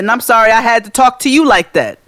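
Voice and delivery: emphatic acted comeback; pointed retold apology. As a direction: apologize while still mildly scolding.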